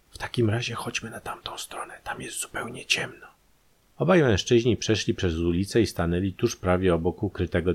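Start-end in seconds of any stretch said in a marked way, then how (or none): 0.1-3.3 hushed, voiceless conspirator's whisper; secretive and whispered, barely any voice; furtive whisper. A narrator's reading. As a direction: whisper a wary suggestion to an accomplice. Conspirators avoiding being noticed.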